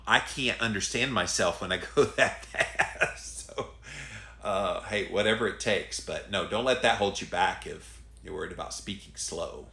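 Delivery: ordinary talk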